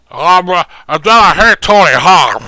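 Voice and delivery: very loud, high cartoon voice; hammed-up mystery character; odd put-on voice. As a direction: blurt it out in a silly character voice. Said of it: "Who, who is that voice".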